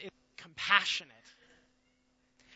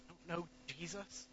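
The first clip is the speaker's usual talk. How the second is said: much softer, regretful repeat; broken murmur; 15 dB quieter than usual. Acted. Peter repeating his denial in shame, soft murmur. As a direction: let it fall away in shame.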